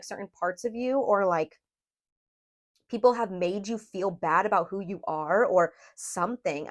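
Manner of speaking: ordinary delivery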